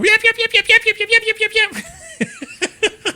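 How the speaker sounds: very high, frantic little-dog yipping; Mozart. Acted. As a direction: yip nonstop like a tiny yapper dog. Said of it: "the little yapper dog".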